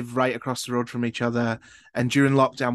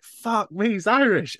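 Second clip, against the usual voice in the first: higher-pitched, mildly astonished reaction; retold surprise; 9 semitones higher, 3 dB louder, slower than usual. Host retelling their reaction while researching the guest, mildly surprised. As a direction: recreate a sudden realisation, pitched up.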